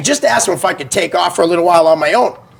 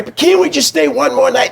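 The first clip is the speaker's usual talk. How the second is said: lightly imitated pleading, pitched up; pleading child; 7 semitones higher than usual. Kid begging parents for one more night, mock pleading. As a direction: beg your parents, voice lifted.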